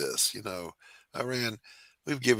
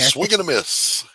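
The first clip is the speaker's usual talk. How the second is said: high, loud, slow announcer call; hammed-up baseball call; mock announcer; 12 semitones higher, 8 dB louder, slower than usual. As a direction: call it like a strikeout. Mocks a failed joke.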